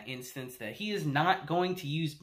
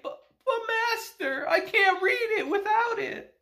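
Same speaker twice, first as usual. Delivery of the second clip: much higher, whimpering mock plea, laughing through it; a pitiful old mage begging, squeaky and high; whiny pleading. Mage begs his master for the eyeglass, whining high. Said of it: "And he's like".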